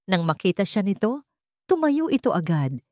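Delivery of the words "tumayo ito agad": usual speaking voice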